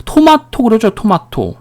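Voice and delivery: "토마토" as "to-ma-to", flat "ah" in the middle; "토마토" with Japanese-style plain vowels; Japanese-style pronunciation. Shown as a demonstration. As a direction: mispronounce tomato the Konglish way, "토마토". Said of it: "일본식으로 발음을 배웠기".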